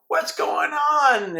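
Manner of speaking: high, slow, bewildered king asking; astonished king. Retold or imitated. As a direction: stretch it out like a baffled king. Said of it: "the king's like".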